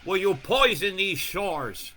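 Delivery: loud, slow and accusing; the birds' lament drawn out; voicing the birds. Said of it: "These beautiful birds say".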